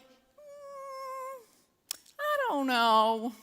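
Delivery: long high hum into slow waffling; hesitant dithering. Retold or imitated. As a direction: hum doubtfully, then drag the words. Acting out her indecision to husband.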